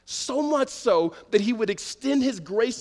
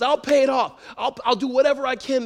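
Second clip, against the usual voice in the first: higher, louder mock plea; acted pleading; 6 semitones higher, 5 dB louder than usual. Debtor begging the king over his debt, pleading. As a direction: plead for time in a raised voice. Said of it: "And he pleaded with him".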